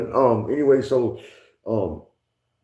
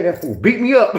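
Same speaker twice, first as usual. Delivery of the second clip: louder words through laughter; laughing; 7 semitones higher, 5 dB louder than usual. Real, not acted. Telling how cellmate beat him, laughing.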